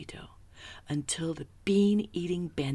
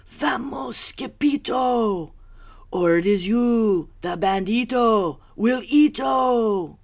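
loud, high, frantic warning; panicked crying out; 8 semitones higher, 13 dB louder than usual. A narrator's reading. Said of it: "they cried".

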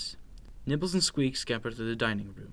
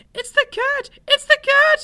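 very high, loud mouse squeal; panicked cry; 14 dB louder than usual. A narrator's reading. Frightened little mouse warns his sister of the cat, panicked cry. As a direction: squeal the alarm at the top of your voice. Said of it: "he cried".

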